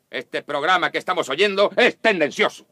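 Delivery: ordinary talk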